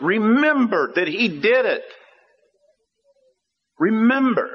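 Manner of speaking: high, forceful exhortation; emphatic preaching. Real, not acted. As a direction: press each command on the congregation.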